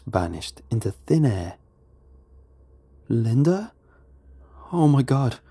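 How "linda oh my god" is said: mildly raised, startled call. A narrator's reading. Man recognising his wife's voice, calling out. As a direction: call to her in disbelief. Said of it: "I called out".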